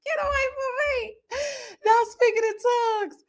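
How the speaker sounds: high, laughing almost to tears; crying with laughter. Real, not acted. Giggling at the man on screen, near tears. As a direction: squeal it through tears of laughter.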